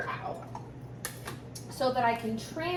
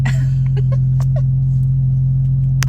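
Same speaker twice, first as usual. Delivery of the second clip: high breathy giggle; 12 dB louder than usual. Real, not acted. Giddy laugh over jelly news.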